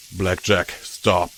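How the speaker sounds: low, even and steady; calm. A narrator's reading. Companion calmly telling her to stop. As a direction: say it calmly, no alarm. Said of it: "P twenty-one said calmly".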